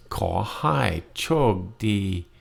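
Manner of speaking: Ko hai chok di said very slowly; slow demo. Shown as a demonstration. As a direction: stretch Ko hai chok di out for learners.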